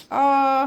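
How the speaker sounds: sudden high loud ouch cry; a sharp startled cry from a burn; yelp of pain. Real, not acted. Burning herself on the hot roasted corn, a sudden sharp ouch.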